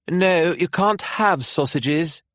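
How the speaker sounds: a firm British mum saying no; British mother refusing her son sausages, high and slow; strong British accent. A narrator's reading. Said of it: "in a strong British accent".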